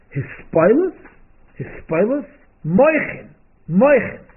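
a hint of high, loud, impassioned pleading; passionate last words. Dying Rebbe answers his son, lightly impassioned and loud. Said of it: "the music of these words".

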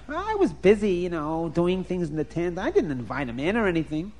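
high, careless wife voice; first wife voice. Acted. Wife brushing off the old visitor, high and careless. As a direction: toss it off like a lazy wife.

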